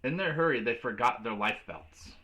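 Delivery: usual speaking voice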